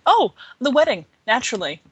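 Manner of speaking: bright-stepping "oh", then easing down; breezy. A narrator's reading. Friend answering over lunch, breezy. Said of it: "her friend replied breezily".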